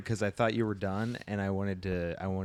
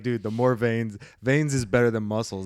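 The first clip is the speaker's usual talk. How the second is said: put-on loud, eager kid voice; excited kid; 7 dB louder than usual. Kid self quoted excitedly.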